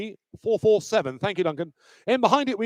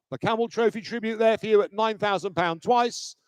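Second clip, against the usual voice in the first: ordinary talk, nothing marked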